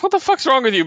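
high, incredulous retort; mock outrage. Teammate refusing Sinister's request, mock outraged.

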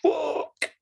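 high, slow, stretched-out shocked cry; drawn-out, high disbelieving yell; shocked friends. Retold or imitated. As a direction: draw it out like a stunned friend. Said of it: "all his friends were just like".

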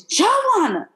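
hammed-up shout of an angry mom; imitating mom's yell from the other room. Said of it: "all I hear is".

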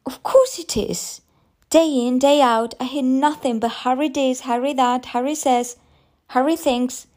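high-pitched fed-up outburst; overwrought, complaining rise; exasperated. A narrator's reading. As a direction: reel off the list in exasperation. Mother bursts out about endless Harry.

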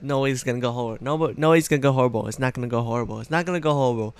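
fast, gabbled inner denial; quick mock reassurance to himself; rushed denial. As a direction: rattle it off trying to convince yourself. Denial in his head during dream.

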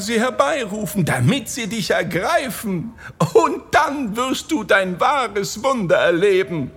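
high, scolding woman's voice; wife calling out threats. A narrator's reading. Wife threatens the locked-in thief, high calling voice. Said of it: "rief sie dem Dieb zu".

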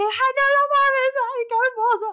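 screamed high and loud in delight; fangirl scream. Retold or imitated. Reliving her concert reaction, an ecstatic fan scream. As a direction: squeal like you're in the front row.

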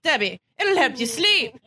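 imitated Irish granny, coaxing; hammed-up Irish grandmother; Irish-accented grandmother. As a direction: coax in a put-on Irish lilt. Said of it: "an Irish accent".

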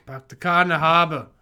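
loud mock Boston accent. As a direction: drop the Rs in "car" and "harbor" like a Bostonian. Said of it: "put the Boston accent".

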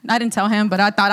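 normal talking voice

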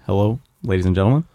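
low, halting presidential address; Barack Obama. Acted. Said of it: "here's my really bad Obama".

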